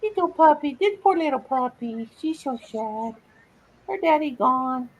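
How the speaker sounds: high sing-song talk to a sad puppy. Real, not acted. Owner soothing her sad puppy in baby talk. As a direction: coo to the little dog.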